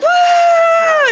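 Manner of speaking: high, loud whooping cheer; crowd cheer. Retold or imitated. Festival crowd cheering when the storm stops, high whoop. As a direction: let out a celebratory "woo". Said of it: "everyone starts cheering".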